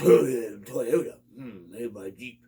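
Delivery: sneering mock voice, trailing off slow; mocking Jeep guys. Mocking Jeep owners who scoff at Toyota, sneering. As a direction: sniff at Toyota like a snob. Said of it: "A lot of Jeep guys".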